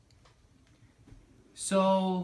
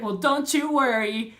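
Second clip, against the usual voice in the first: mock reassurance; 9 semitones higher, 7 dB louder than usual. Technician's breezy reassurance, retold.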